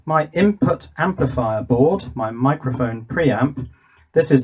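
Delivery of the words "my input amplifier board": ordinary delivery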